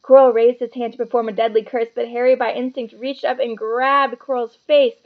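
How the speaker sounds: usual speaking voice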